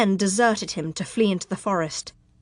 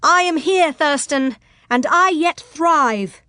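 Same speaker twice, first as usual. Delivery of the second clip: high, ringing, defiant declaration; loud, high, slowing for emphasis at the end; indignant cry; 7 semitones higher, 5 dB louder, slower than usual. Acted. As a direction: proudly call it out to the enemy. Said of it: "Abrielle cried indignantly".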